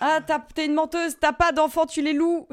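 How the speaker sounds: mock accusation. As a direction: accuse her playfully, slow and loud. Teasing accusation, loud and high.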